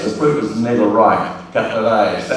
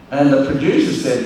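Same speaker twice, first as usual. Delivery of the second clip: ordinary talk, nothing marked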